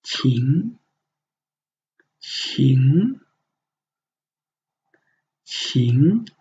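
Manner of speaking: teacher's model "qing"; Chinese qing. Shown as a demonstration. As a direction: say "qing" with a clean Mandarin tone, repeated. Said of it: "the pinyin should be".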